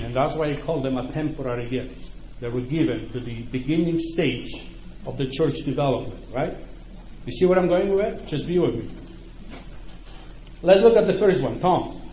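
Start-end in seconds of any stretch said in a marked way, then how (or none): none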